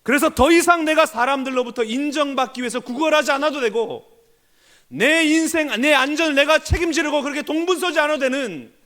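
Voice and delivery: loud, driving sermon voice with hammered peaks; fervent preaching. Acted. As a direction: preach it hard to the congregation. Preaching at full force. (힘있게 외치는 설교)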